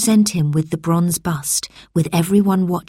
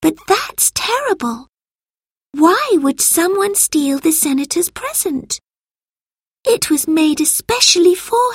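put-on very high-pitched child's voice, shocked; shocked girl; 11 semitones higher than usual. A narrator's reading. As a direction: protest in disbelief as a little girl, pitched right up. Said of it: "Lucy was shocked".

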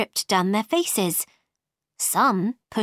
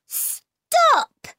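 sudden high-pitched cry. A narrator's reading.

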